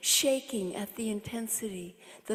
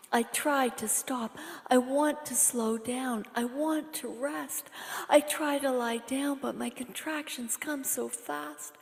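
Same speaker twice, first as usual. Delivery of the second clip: usual speaking voice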